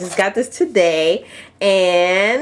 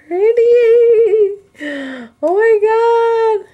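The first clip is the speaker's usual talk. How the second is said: very high, louder squeal of delight; 4 dB louder, faster than usual. Real, not acted. Crafter seeing how pretty the doilies are, delighted squeal.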